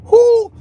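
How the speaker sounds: sudden high loud whoo; a loud high whoo of disbelief; whooping exhale. Real, not acted. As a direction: let out a big overwhelmed whoo. Overwhelmed by the topic.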